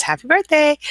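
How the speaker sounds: high, loud cheerful read; bright birthday greeting; excited birthday wish. Real, not acted. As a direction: chirp it out brightly. Reading birthday wish from chat.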